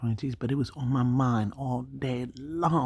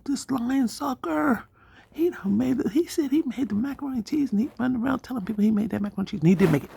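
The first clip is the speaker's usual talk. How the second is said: indignant rant; 8 semitones higher than usual. Retold or imitated. Outraged at the liar.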